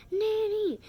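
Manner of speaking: very loud, high-pitched scream; acted echoed scream. Pupil mimicking the tutor's call for Nanny, acted scream. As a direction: copy the scream at full volume. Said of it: "Then I scream".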